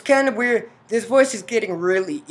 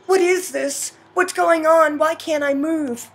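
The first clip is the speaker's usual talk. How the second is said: higher, urgent questions; panicked heroine; 10 semitones higher, 6 dB louder than usual. Acted. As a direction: act out waking up unable to move. Heroine finds herself paralysed.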